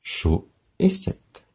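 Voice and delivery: slow, Chaud et sept spaced. Shown as a demonstration. Teacher to pupils, slow syllables. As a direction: spell out Chaud et sept slowly. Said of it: "Deux syllabes dans chaussette".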